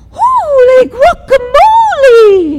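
very high, loud, stretched-out gasp; startled exclamation. Acted. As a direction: blurt it out in shock. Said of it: "he exclaimed".

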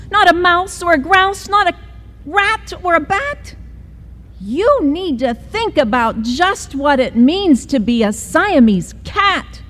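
high, stern mom voice listing off animals; mother lecturing her kitten, high and firm. Acted. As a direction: scold him item by item. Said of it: "she added".

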